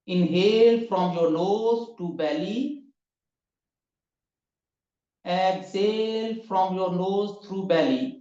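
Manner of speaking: slow, drawn-out breathing instructions; guided breathing. Acted. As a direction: stretch each word like a long breath. (धीमी, लंबी साँस वाली आवाज़)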